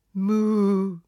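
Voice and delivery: loud mooing cow voice; lowing cow. A narrator's reading. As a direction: moo the words out like an old cow. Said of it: "råmade Majros".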